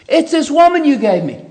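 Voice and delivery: high, loud accusing cry; Adam shifting blame onto the woman; Adam blaming. Retold or imitated. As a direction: point the finger hard at her. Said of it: "Adam blames God".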